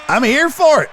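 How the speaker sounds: loud, high cheer; real excitement. Real, not acted. Streamer eager to watch old people fight, excited shout. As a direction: shout it like a fan relishing a fight.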